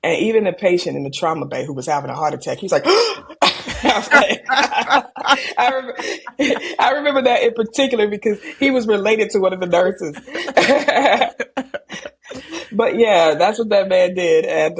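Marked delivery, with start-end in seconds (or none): 2.8-11.4 talking through real laughter; laughing. Real, not acted. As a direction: laugh as the words come out. Laughing while retelling the scene.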